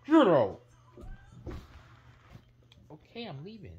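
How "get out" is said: loud angry order; angry shout. Acted. Mario throws Chocolate Bar out, loud angry shout. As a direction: throw him out loudly.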